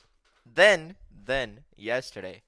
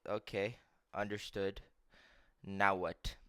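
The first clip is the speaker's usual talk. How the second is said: soft, lowered, restrained acceptance; respectful compliance; 4 semitones lower, 8 dB quieter than usual. Retold or imitated. Grandson hiding his anger from grandma, quietly respectful. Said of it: "I have to be more respectful".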